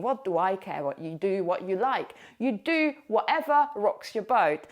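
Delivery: ordinary talk, nothing marked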